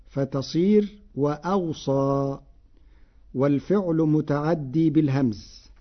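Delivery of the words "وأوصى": "وأوصى" as "wa-awsa", hamza between the waws, single light ص; added hamza. Shown as a demonstration. Teacher showing Warsh's reading, "وأوصى" said wa-awsa. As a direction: slowly break "وأوصى" with a hamza, second و unvowelled. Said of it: "بهمزة مفتوحة بين الواوين".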